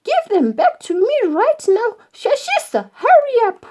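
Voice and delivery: very high, loud, urgent demand; Nanana shouting. A narrator's reading. Mother ordering the elephant to return her children, shouting. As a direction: shout the order, pitch shooting up. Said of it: "shouted Nanana".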